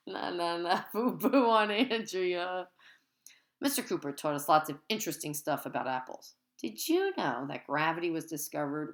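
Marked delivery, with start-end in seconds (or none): 0.1-2.7 slow sing-song taunt. A narrator's reading. Boy taunting the girl, slow sing-song. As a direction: tease her slowly.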